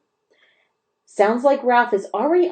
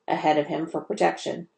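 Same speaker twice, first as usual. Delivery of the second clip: plain reading voice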